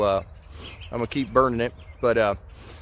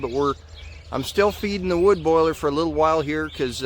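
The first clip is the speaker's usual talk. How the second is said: ordinary talk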